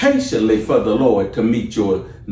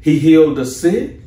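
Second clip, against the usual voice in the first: rising, fervent preaching, pitched higher; 4 semitones higher than usual. Acted. Preacher rousing the church about Jesus' works, fervent and high. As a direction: lift the congregation, building fire.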